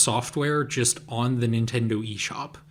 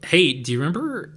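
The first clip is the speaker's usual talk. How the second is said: usual speaking voice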